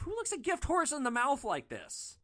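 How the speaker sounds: high, loud incredulous thought; can't believe their nerve; exasperated disbelief. Retold or imitated. Driver thinking about ungrateful passengers, loud disbelief.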